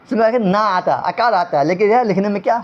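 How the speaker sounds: normal talking voice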